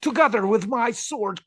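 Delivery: high, loud, fiery Argentine politician; Javier Milei impression. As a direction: rant like a fired-up Milei. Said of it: "he says".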